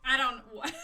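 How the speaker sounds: ordinary talk, nothing marked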